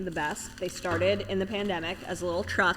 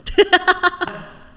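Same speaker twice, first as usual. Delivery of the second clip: loud squealing laugh; high burst of laughter; 11 semitones higher, 8 dB louder than usual. Real, not acted. Laughs at Diet Coke.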